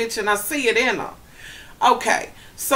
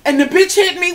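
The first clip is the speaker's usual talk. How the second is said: mocking whiny victim; 8 semitones higher, 4 dB louder than usual. Mocking the crying guest.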